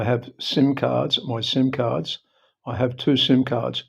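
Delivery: usual speaking voice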